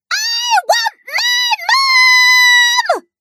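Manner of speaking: extremely high shriek; shrieking little princess. A narrator's reading. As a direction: shriek it like a small girl. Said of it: "shrieked the little princess".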